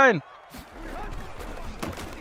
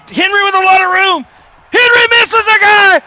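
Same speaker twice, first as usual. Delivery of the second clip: excited shout; 10 semitones higher, 8 dB louder, slower than usual. Pretend. Mock play-by-play touchdown call.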